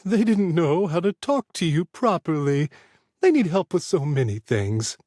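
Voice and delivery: a lightly voiced meddling old woman; mischievous old lady.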